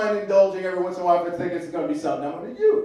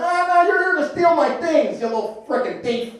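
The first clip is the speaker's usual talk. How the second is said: Uncle Mike voice; 11 semitones higher, 3 dB louder than usual. Retold or imitated. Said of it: "Uncle Mike accuses nephew".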